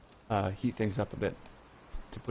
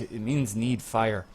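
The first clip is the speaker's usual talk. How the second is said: ordinary talk, nothing marked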